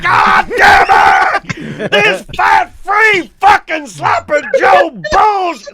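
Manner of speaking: slow, drawn-out hammed-up roar; enraged dad. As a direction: imitate a dad screaming in rage at dinner. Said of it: "My dad would be like".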